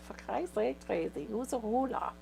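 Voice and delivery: put-on exasperated German accent; a hint of the German boss. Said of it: "She likes your German accent".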